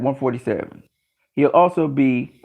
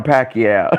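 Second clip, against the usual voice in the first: usual speaking voice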